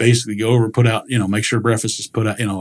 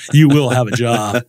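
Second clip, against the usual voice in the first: stern parent; 2 semitones higher, 5 dB louder, slower than usual. Retold or imitated. Parents' stern instruction retold.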